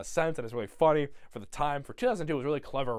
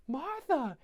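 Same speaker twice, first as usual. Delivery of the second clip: high-pitched mock exclamation; character exclamation; 11 semitones higher than usual. Seeing Martha in lingerie, high imitated exclamation. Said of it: "And she goes".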